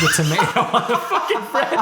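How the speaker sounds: breaking into laughter mid-sentence; laughing. Real, not acted. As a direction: crack up as the sentence ends. Cracking up while retelling video.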